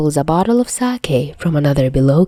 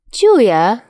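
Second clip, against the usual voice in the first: sing-song call, pitched high and a bit louder; carolling; 6 semitones higher, 6 dB louder than usual. A narrator's reading. Dazai greeting Chuya from the couch, sing-song high call. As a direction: lilt his name up like a song. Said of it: "Dazai carols".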